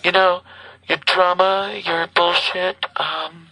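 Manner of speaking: tinny recorded phone message; like a voicemail played back; answering machine message. Real, not acted. Playing the woman's voicemail.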